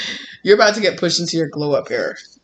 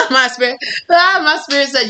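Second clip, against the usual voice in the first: ordinary delivery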